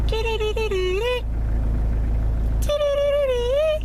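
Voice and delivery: very high chirping dee-dee-dee; high piping birdsong mimicry; bird call imitation. Shown as a demonstration. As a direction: whistle it like a tiny warbler. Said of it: "a song that goes".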